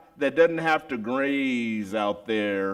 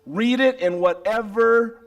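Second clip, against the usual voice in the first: louder, higher preaching build; 10 semitones higher, 6 dB louder than usual. Acted. Preacher to congregation, full force. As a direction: drive the point home.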